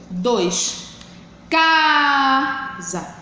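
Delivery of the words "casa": long CA in "Casa", short SA after; CAAA... sa, as a stress demo; stressed syllable drawn out. Shown as a demonstration. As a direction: hold the first syllable of "Casa", then break before SA. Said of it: "pronunciado com força".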